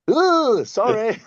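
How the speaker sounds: tiny high-pitched squeaked apology; sheepish mock apology. Player apologising to the colonel at half time, sheepish squeak. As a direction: shrink into a very high, meek squeak. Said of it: "I went and apologised to him".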